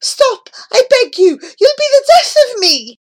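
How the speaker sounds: loud, high begging; desperate cry. A narrator's reading. Old man begging the fiddler to stop, desperate high cry. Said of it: "cried the old man".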